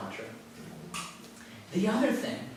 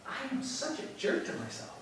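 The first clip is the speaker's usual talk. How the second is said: amused self-noticing; 10 semitones higher, 10 dB quieter than usual. Retold or imitated.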